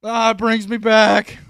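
high, loud, drawn-out veteran wail; hammed-up cry of a triggered old soldier; flashback-stricken vet. Vet hit by basic training memories, loud mock anguish. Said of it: "he's like".

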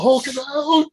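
cheering the robot's rage, high mock yell; hammed-up battle yell. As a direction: scream it like a cartoon powering up. Blastar powering up in rage.

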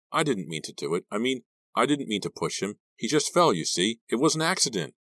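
high, rising frantic explanation; upset, increasingly high-pitched self-defence; panicked pleading. A narrator's reading. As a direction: plead that it was not on purpose. Panicked Della explains a deadly push.

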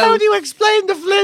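high, put-on questioning voice; mock scientist question. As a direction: play the scientist asking. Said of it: "he'll go".

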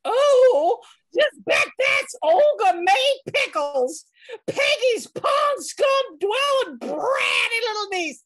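loud furious Southern rant; angry rant. Acted. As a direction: shout her fury at the whole clan. Kitty rants about rival niece.

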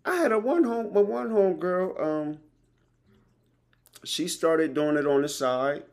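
high, loud and eager about the side hustle; excited. Real, not acted. Bragging to a friend about a home girl's earnings, excited and high. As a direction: pitch it way up, thrilled for her.

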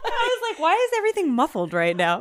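high laughing voice through the words; giggling at her own mistake. Real, not acted. Laughing while telling her sister about the AirPods.

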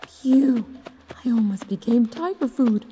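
high, loud thief voice; relieved thief. Acted. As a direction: burst out after a narrow escape. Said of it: "he thought to himself".

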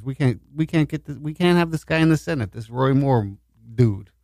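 ordinary talk

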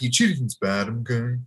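jokey cartoon-character impression; put-on voice, mock-lecturing a kid; Mister Mac. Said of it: "like, Mister Mac".

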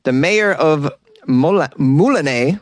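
ordinary delivery

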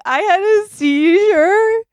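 high, slow retold crying wail; mock sobbing. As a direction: wail it like a dramatized sob. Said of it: "Immediately start crying".

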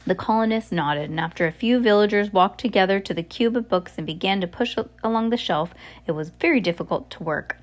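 ordinary delivery

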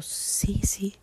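small, hushed yes; timid reply. Retold or imitated. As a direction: answer softly, as if stunned. Ella responde asustada y confundida. (respuesta bajita y tímida)

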